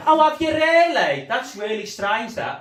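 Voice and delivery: high, loud, slow sarcastic disbelief; hammed-up fake interest; mock sarcasm. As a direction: mates mocking him for saying he was kidnapped. Sarcastic mates mocking kidnap claim.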